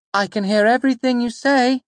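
high, sweet teasing mother's call; sweetly calling mother. A narrator's reading. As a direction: call sweetly from inside the wagon. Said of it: "my mother called sweetly from inside".

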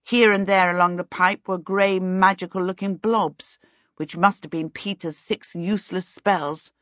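ordinary delivery, nothing marked

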